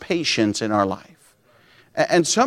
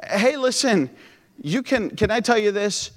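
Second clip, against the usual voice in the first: ordinary talk, nothing marked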